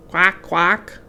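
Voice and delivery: loud quacking duck voice; a duck's noisy answer. Acted.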